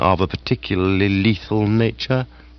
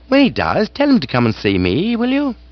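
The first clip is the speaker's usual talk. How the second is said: a touch higher, lightly voiced gentleman; brisk request, lightly raised; character voice; 10 semitones higher than usual. Bertie asking the butler to send Jeeves.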